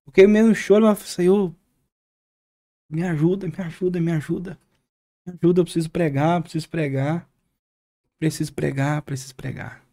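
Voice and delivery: half-sobbing prayer, retold; retold tearful plea. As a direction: beg the Lord for help to preach. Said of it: "e eu falava assim".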